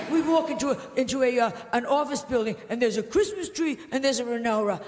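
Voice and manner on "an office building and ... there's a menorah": ordinary talk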